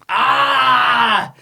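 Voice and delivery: high, drawn-out "ah" of frustration; exasperated scream. Real, not acted. Host wanting to scream about this, exasperated high cry. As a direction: let out a pent-up cry after a sigh. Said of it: "I just want to scream about this".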